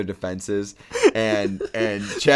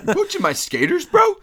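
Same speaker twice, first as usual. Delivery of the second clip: much higher, squeaky bro voice; squeaky mock quote; 5 dB louder than usual. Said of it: "Rob Corddry".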